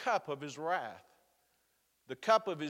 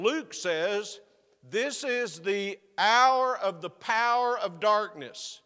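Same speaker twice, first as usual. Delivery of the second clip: preaching with rising force, louder; 4 semitones higher, 6 dB louder than usual. Acted. Preacher stirring his congregation, rising forceful preaching. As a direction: lean into it like a preacher at the pulpit.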